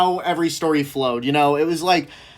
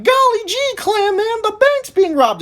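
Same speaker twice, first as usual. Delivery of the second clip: put-on high, bright-eyed gee-whiz kid voice; jolly comic sidekick. Jimmy alerting Clam Man to a bank robbery, high gee-whiz voice. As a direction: pitch way up like an eager fifties sidekick. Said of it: "a happy-go-lucky, jolly character".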